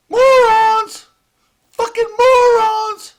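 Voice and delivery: high, loud yelled insult; furious shout. Real, not acted. Yelling at protesters, a high furious shout. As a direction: scream it at them.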